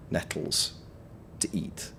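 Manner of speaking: breathy hushed whisper of the words; whispered aside. Real, not acted. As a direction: drop to a whisper for the punchline. Explaining edible nettles, hushed aside.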